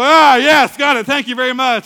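very loud, high, jubilant outburst; exultant mock-genius cry; triumphant shout. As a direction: crow it to the whole room. Triumphant student shows off answer.

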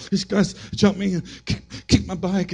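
put-on boy telling his dad he was jumped; upset kid. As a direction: tell it like a shaken boy.

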